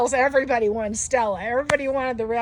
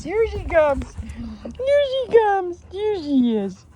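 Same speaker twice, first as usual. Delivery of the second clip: rising into a high, slow cooing voice; 7 semitones higher, much slower than usual. Real, not acted. Cooing at the mother dog.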